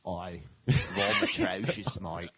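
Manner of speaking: put-on slow, hammy Australian accent with a laugh. Australian accent joking about [name]'s Melbourne roots. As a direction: ham up an Aussie bloke saying vom atrocious. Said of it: "That would be the best accent if [name] talked like that".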